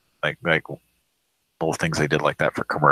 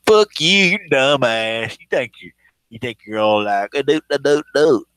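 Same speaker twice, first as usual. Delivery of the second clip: mock angry caller; 7 semitones higher, 4 dB louder than usual. Host imitating the angry man on the call, loud and mocking. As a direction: bark it like an offended yokel. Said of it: "He's like".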